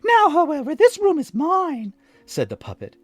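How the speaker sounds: very high, loud puppet. A narrator's reading. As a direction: pipe up like a wooden boy. Pinocchio voice.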